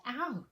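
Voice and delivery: loud yelp on landing; little ow. Acted. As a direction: squeak on the bump. Piglet landing.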